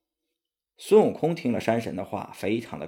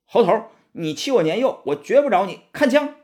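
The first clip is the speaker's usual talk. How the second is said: high, loud furious threat building to a yell; angry battle cry; 9 semitones higher, 5 dB louder than usual. A narrator's reading. Red Boy attacks Monkey with his spear, rising furious yell. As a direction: rage up to the strike.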